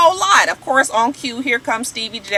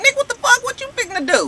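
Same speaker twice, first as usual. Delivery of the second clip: much higher, sharp outraged yell; mock yell; faster than usual. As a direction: yell it high like you caught him. [name] yelling at [name].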